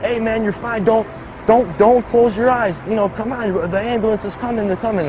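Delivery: urgent paramedic. Retold or imitated. Paramedic begging a dying kid to keep his eyes open, urgent and high. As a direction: keep him awake, pleading high. Paramedic urging him to stay awake.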